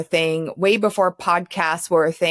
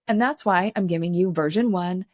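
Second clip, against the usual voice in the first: ordinary talk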